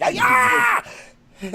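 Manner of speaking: high-pitched mock karate yell; comic martial-arts battle cry; karate-chop cry. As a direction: let out a hammed-up chop shriek. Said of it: "Fred Flintstone fucking karate chopped that shit".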